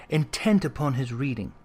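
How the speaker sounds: ordinary delivery, nothing marked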